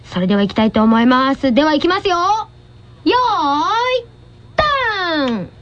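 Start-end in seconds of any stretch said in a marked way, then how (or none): 2.2-5.5 long drawn-out ready, then go; slow starter's countdown with a ringing release; starting call. Real, not acted. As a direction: call the race start, stretching each word. Host gives the starting call.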